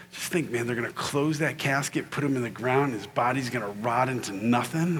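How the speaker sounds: low, hushed funeral confidence; morbid musing. Retold or imitated. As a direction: muse on it quietly, keeping it low. Morbid musing retold to sibling.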